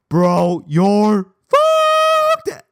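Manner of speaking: high, loud drawn-out yell; gleeful mock alarm. Classmates turn to the doomed boy, squealing mock yell. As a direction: yell it, stretching the last word into a squeal. Class reacting to him, acted.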